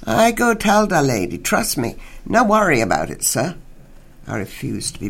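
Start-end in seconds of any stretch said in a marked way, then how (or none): 0.0-3.5 higher, louder officer with broken English; brushing-off policeman. Acted. Officer dismisses the nosy old man, lightly accented. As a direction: wave him off, talking down.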